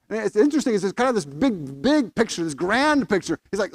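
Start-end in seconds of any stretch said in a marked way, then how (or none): none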